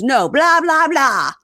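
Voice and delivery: mock dismissive husband. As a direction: mimic the nagging as noise. Mocking wife's complaints.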